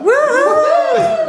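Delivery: high, loud whooping cry; loud cheer. Acted. Mourner doing Mary's Tennessee cheer, a loud high whoop. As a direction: whoop like a football fan.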